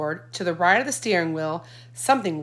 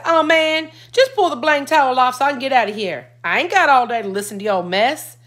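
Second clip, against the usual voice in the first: impatient; 6 semitones higher, 7 dB louder than usual. A narrator's reading. Impatient teen brother complains.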